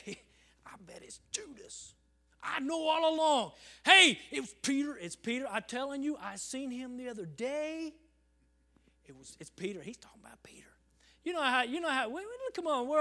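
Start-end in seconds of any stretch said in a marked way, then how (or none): 0.0-9.8 gossiping churchgoers. Retold or imitated. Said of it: "everybody would be saying". 9.8-10.7 hushed gossip whisper. Retold or imitated. Churchgoer whispers to a neighbour, hushed.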